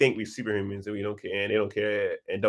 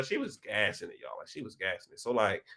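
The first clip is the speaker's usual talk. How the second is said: normal talking voice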